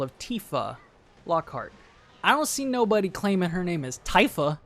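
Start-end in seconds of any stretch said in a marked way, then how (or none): 2.2-4.6 exasperated shout. Real, not acted. Mocking rant, raised voice.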